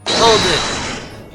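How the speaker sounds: loud, high courtroom shout; shouted objection. Acted. Defender interrupting witness in cross-examination, shouted objection. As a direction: cut the witness off with a big shout.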